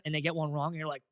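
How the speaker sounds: ordinary talk, nothing marked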